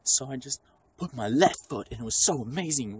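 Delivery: high, loud gushing student voice; mock rambling student. Mocking an unfocused student in school, high gushing voice. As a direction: gush like a student giving a bad report. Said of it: "you can't be like".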